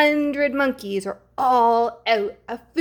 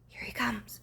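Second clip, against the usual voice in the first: hushed suspense whisper; a breathless storybook whisper; 2 semitones higher, 11 dB quieter than usual. A narrator's reading. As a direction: whisper so the monster won't hear. Monster approaching.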